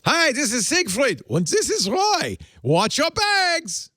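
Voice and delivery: high, campy Siegfried and Roy voice, stretching watch your bags slowly; high, hammed-up Vegas announcer; mock celebrity announcement. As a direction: ham it up like Vegas showmen on the PA. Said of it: "It's like that in Las Vegas".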